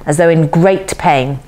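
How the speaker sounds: ordinary delivery, nothing marked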